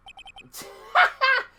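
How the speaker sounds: a very high, cartoonish witness laugh. Cocky witness laughing very high at the glove. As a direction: cackle way up high.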